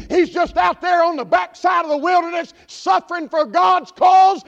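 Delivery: full-force preaching. Acted. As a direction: build the sermon into a fiery shout.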